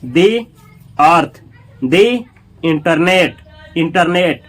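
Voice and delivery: "दी" said as a drawn-out dee, then a pause; long ee in "दी" before "earth" and "internet". Shown as a demonstration. Teacher shows examples before vowels, the pronounced dee. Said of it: "कैसे आप लोग दी का".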